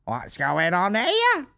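high, slow put-on voice of a TV viewer; hammed-up Gogglebox viewer. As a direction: drawl it like a Gogglebox couch critic. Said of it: "everyone off Gogglebox".

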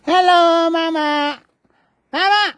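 calling out. Real, not acted. Calling out to Mama.

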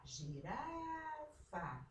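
"Girafa" with RA high and long drawn; stress on RA. Shown as a demonstration. Teacher shows pupils the strong syllable, stressing RA in "Girafa". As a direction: stretch RA high in "Girafa", then drop. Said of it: "mais forte".

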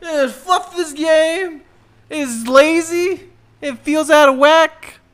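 high, loud exasperated shout; fed-up rant. Real, not acted. Gamer ranting loudly at a frustrating game. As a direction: vent at the game, half joking.

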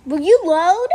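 loud, exasperated plea at the screen; fed-up shout at a frozen screen; frustrated. Real, not acted. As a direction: yell at the game to load. Game won't load.

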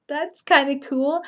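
usual speaking voice

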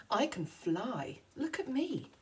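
plain reading voice

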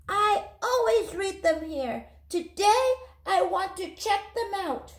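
put-on stubborn child insisting; determined boy. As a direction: speak up like a small boy standing his ground.

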